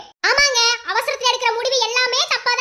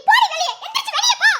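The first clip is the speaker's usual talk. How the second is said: high, loud furious command to leave; angry order out; 7 semitones higher, 3 dB louder, faster than usual. Acted. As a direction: throw her out of the house. Throwing her out in anger.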